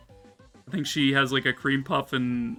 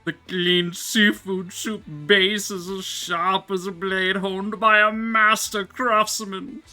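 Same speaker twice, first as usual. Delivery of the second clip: loud, grand ramen critic verdict; rapturous tasting food, savouring each word; 4 semitones higher, 4 dB louder than usual. Pretend. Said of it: "Itsuki food critic voice activate".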